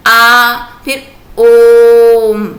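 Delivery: आ then ओम, both held very long and loud; prolonged vowel. Shown as a demonstration. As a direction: draw आ out, then ओम even longer. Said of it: "आ बोलिए और ओम बोलिए". (आ और ओम, बहुत खींचकर ज़ोर से)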